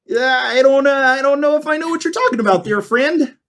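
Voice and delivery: high, sweet small-town lady voice; lightly laughing hostess greeting strangers; small-town woman. Acted.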